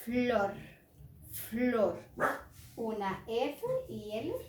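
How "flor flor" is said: "flor" with F and L lengthened and joined; sounding out "flor". Shown as a demonstration. Teacher shows how F and L sound, stretched "flor". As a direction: stretch "flor", falling each time.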